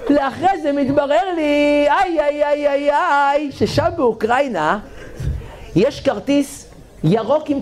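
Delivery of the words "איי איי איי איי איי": comic wail. Acted.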